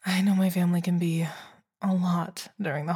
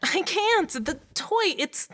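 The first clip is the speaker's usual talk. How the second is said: panicked; 10 semitones higher than usual. Acted. Panicking, toy stuck.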